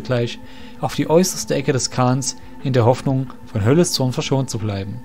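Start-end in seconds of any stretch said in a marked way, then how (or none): none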